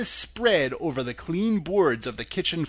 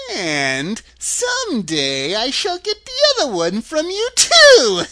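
high, gloating threat swelling into a cackle; wicked witch taunting with glee; witch laughing mockingly; 10 semitones higher, 8 dB louder than usual. A narrator's reading. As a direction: build to a loud, high shriek, then cackle. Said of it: "laughing at her".

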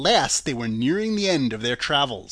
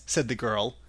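usual speaking voice

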